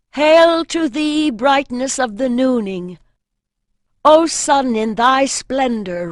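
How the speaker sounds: slow, loud, high solemn hymn to the sun; ritual invocation. A narrator's reading. Priestess hailing the sun at noon, slow ringing invocation. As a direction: proclaim it to the noon sky like a priestess at the altar.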